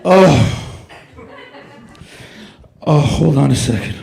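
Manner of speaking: loud, gasping and panting after a climb; winded and laughing between gasps; out of breath. Acted. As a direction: heave each word out like you just ran seventeen flights of stairs. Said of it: "that's how tired I am".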